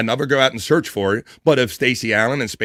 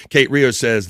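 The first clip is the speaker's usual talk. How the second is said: ordinary delivery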